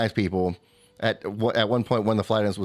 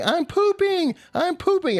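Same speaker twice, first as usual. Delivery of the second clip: put-on high, shrill child's yell, much higher; little girl yelling; slower than usual. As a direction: yell it like a small child in a panic.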